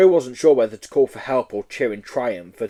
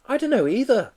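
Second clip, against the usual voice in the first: put-on high childlike call to mummy; little boy voice; 11 semitones higher than usual. Small boy calling to his mummy, high childlike voice. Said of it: "said the boy".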